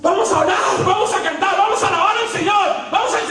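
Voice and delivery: mock a youth leader rousing the group; hammed-up worship leader. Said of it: "toda una vaina teatral".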